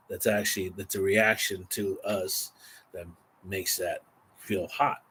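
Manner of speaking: normal talking voice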